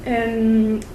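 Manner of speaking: normal talking voice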